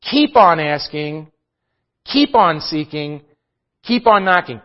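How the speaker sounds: slow, stressing each keep; drawn-out keep on. Shown as a demonstration. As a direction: press every keep, patiently. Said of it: "A present imperative is".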